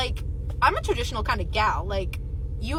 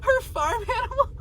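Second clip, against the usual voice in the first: fake crying; 13 semitones higher than usual. Real, not acted. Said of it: "I might be an old hag".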